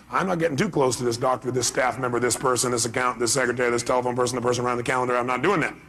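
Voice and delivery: low, level muttered listing; acted grumbling avoidance, low-pitched; wary refusal. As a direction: reel off the list in a low monotone. Voicing wary people avoiding the driver.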